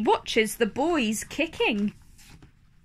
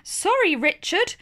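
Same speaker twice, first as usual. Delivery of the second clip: Mummy Rabbit; 7 semitones higher than usual. Acted. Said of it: "says Mummy Rabbit".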